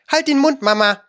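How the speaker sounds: put-on high, pert young voice; cheeky. A narrator's reading. Young creature to its mother, cheeky. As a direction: answer back sharply in a high voice. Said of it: "sagte die junge Unke etwas naseweiß".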